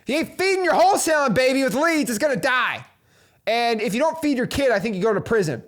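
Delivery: ordinary talk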